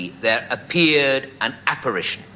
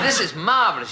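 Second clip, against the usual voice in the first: higher, still excited but calmer repeat; delighted; 7 semitones higher than usual. Retold or imitated. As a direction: say it again, savouring it. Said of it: "he said".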